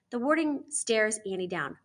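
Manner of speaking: ordinary delivery, nothing marked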